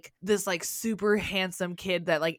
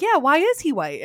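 put-on kids chiming in; 7 semitones higher, 8 dB louder than usual. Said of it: "all the kids were like".

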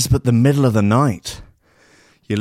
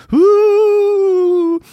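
high, loud spooky wail; a cartoon ghost fading away; ghostly woo; 5 dB louder than usual. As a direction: ghosts drifting off into the ether. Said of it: "they all drift off into the ether".

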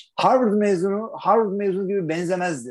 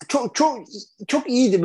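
excited; 8 semitones higher than usual. Real, not acted. Excited praise, high pitch.

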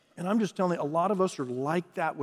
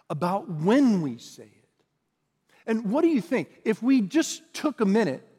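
raised, ringing pulpit voice; preaching at full force; 3 semitones higher, 4 dB louder than usual. Acted. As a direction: preach it out to the whole congregation. Scripture and exhortation, louder.

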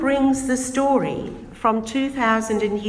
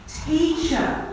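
crying out; 7 semitones higher, 4 dB quieter than usual. Acted. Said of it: "I cried".

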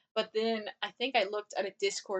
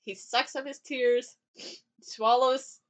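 ordinary talk, nothing marked

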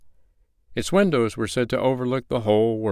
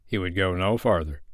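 ordinary delivery

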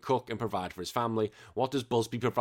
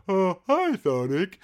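high, dopey cartoon cat voice; slack jawed; 8 semitones higher, 4 dB louder, slower than usual. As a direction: do the dim-witted cat. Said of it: "he has got a bit of a dopey voice".

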